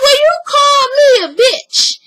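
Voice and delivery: hammed-up wounded mama; offended accusation. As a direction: throw the insult back, scandalized. Mama Joyce accuses Sharon again.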